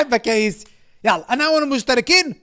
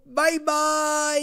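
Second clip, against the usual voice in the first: sing-song goodbye; 6 semitones higher, 4 dB quieter, much slower than usual. Acted. Cheery drawn-out goodbye to AI.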